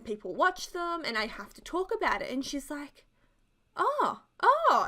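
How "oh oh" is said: high, loud, puzzled "oh, oh"; imitating confused grandma. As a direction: act out a bewildered grandma's reaction. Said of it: "she was so confused".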